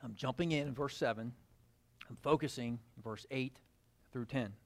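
hushed, low reading of the verse; soft reading. Acted. Preacher softly reading scripture to the congregation. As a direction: drop to a near-murmur for the text.

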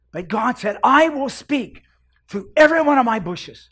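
high, loud, ringing sermon climax; fiery revival preacher, full voice; preaching at full force. Acted. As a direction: build it up and proclaim it over the congregation.

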